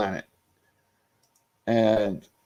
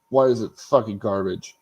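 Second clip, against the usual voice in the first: ordinary delivery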